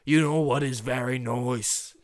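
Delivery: loud mock-Australian accent, nice as "noice". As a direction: put on a hammy Australian accent. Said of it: "It's talking in Australian accent".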